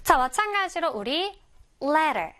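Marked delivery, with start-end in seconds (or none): 1.8-2.3 "letter" with a flapped t, like "lerer"; American "letter"; American pronunciation. Shown as a demonstration. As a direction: soften the t in "letter" to an r-like tap. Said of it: "미국식으로 발음할 때는".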